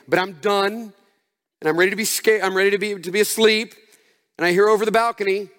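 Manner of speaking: usual speaking voice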